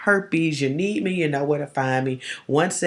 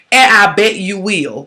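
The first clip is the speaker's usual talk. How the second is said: a louder, slower dare; defiant comeback; 4 semitones higher, 9 dB louder, slower than usual. Shown as a demonstration. Big sister coaching viewers on a defiant comeback, said louder and slower. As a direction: throw it back at them with attitude. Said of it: "this is what you gonna say".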